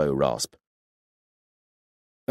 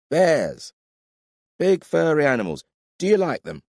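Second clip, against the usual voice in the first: put-on drunk; 12 semitones higher, 5 dB louder than usual. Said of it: "You're drunk".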